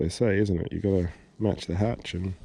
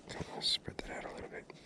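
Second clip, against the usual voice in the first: frustrated whisper to himself; 12 dB quieter than usual. Real, not acted. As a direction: whisper it while fiddling. Whispering while tying fly.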